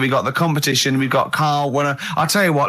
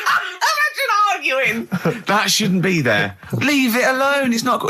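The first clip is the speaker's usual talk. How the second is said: high, slow mock protest; mock argument; 9 semitones higher, slower than usual. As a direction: act out arguing with the doctor.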